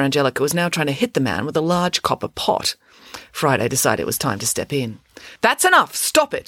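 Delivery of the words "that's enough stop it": high, loud order to stop; commanding shout. Acted. Friday steps in to stop the fight, shouting firmly. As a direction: call a halt to a fight, pitched high.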